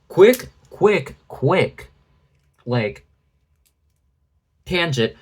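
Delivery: sudden urgent tangent; urgent aside. Real, not acted. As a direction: rush into the tangent.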